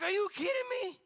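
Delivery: high-pitched disbelief; incredulous rebuke. Retold or imitated. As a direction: throw it out as an outraged question. Holy Spirit's incredulous rebuke.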